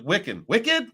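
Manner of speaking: mock correction. Imitating the lady correcting him, high sing-song. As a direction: mimic her correcting, high and bouncy. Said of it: "she goes".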